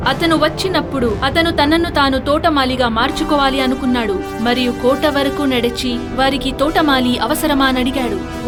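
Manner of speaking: plain reading voice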